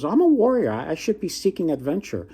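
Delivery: high, proud declaration; a hammed-up warrior eager for adventure; boastful warrior. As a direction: puff up and announce yourself.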